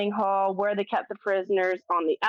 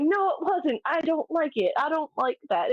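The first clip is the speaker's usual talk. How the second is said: higher, mock-frightened protest; imitating a scared mom; 7 semitones higher than usual. Mom insisting the ghost was no fun, mock-scared and higher. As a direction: play the spooked mother, lightly.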